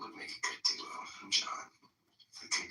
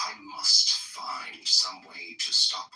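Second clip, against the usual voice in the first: slow, louder hint of a growling Grinch; 14 dB louder, much slower than usual. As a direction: growl it out slowly as the plotting Grinch. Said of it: "Then he growled".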